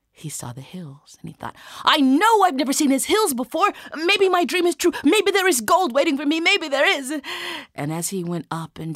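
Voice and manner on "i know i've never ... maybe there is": high, loud rush of hope; giddy rising hope; excited realisation. Acted. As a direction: blurt it out high, hardly daring to believe. Isaac realises dream may be true.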